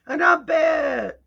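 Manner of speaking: loud, bright announcement; shouting. Acted. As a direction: call your name out big. Shouted self-introduction.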